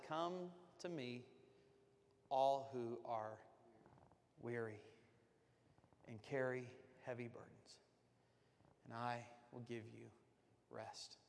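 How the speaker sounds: slow, soft, tender voice of God; tender scripture. Acted. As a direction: let it settle gently. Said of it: "But God said".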